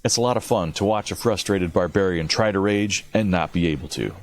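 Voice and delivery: ordinary talk, nothing marked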